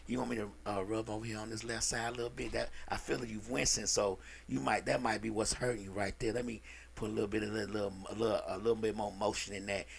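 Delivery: low, soft, fast seductive murmur; smooth masseur voice. Retold or imitated. Masseur sweet-talking the client on the table, low and soft. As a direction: purr it like a slick masseur working a client.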